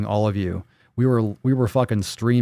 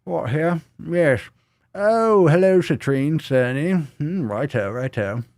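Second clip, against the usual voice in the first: higher put-on accent, pipe in mouth; 6 semitones higher, slower than usual. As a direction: talk through the pipe stem, posh-ish. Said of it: "I can talk with an accent".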